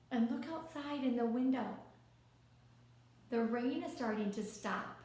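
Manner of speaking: plain reading voice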